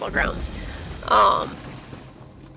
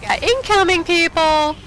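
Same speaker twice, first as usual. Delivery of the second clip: giddy taunt; 8 semitones higher than usual. Real, not acted. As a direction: call the enemies in gleefully. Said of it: "I get way too giddy".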